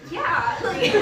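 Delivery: loud, high, breaking into laughter; laughing reply. Real, not acted. Answering the girl from track, loud laughing voice. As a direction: burst out with it, laughing.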